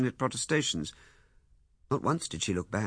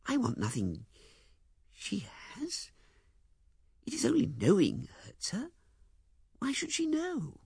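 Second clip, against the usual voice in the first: higher, pleading woman's voice; Miss Heydinger; 7 semitones higher, 4 dB quieter than usual. Acted. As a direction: plead softly as the hurt woman. Female character voice in narration.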